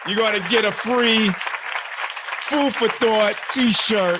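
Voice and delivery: high, loud and slowed way down like a hype man; booming giveaway shout-out; hyped prize announcement. Real, not acted. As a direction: sell the free T-shirt like a radio giveaway.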